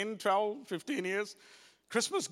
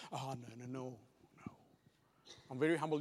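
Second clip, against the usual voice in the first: mock humble refusal; 11 semitones lower, 8 dB quieter, faster than usual. Mock reluctant reply to praise.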